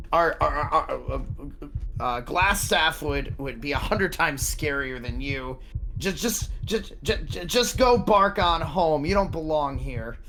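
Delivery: rattled guard tripping over words, pitch jumping up; fearful stammer. Acted. Frightened guard telling the intruder to go home, stammering. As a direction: stutter nervously while trying to sound tough. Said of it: "you hear the fear in their eyes".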